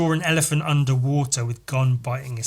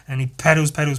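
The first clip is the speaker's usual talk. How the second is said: usual speaking voice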